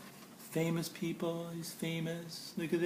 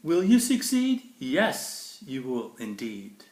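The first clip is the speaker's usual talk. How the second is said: bright, lilting storybook read; sing-song picture book reading; reading to children; 3 semitones lower, 5 dB louder than usual. Acted. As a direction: read it like bedtime verse for a child. Reads Dr. Seuss to kids.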